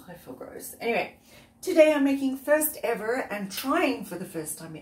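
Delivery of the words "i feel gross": mutter. Real, not acted. Ashamed of damaged nails.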